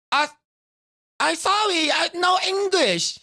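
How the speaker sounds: high, slow broken English, playing a foreigner; fake broken English. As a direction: pretend you can't speak English, high and halting. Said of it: "I was like".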